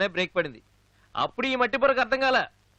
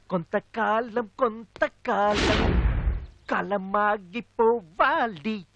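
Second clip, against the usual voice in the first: drawn-out recital; much slower than usual. Acted. Slow dramatic recited line.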